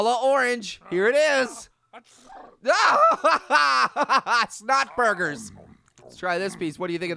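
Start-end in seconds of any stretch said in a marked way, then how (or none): 2.6-4.4 loud, high open laugh; big laugh. Real, not acted. Player reacting to the Yeti's food, loud high laugh. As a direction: burst out in a big belly laugh.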